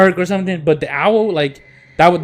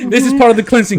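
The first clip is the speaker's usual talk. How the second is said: laughing repeat; 6 semitones higher, 3 dB louder, faster than usual. Retold or imitated. Repeating mock healer line laughing.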